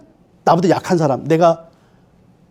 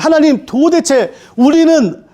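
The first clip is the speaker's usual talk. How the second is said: higher, anguished plea to God; martyrs crying out; 6 semitones higher, slower than usual. Acted. Martyrs pleading with God for justice, high and anguished. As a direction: cry out like the slain saints asking how long. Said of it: "하나님 앞에 이렇게 얘기하는 거예요".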